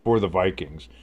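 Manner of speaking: live sports call, rising on the throws; announcer calling the drive; play-by-play. Acted. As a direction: call the game like a broadcaster.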